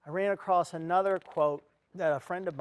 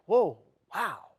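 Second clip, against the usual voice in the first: startled whoa; 9 dB louder than usual. Retold or imitated. Parrot screaming at him.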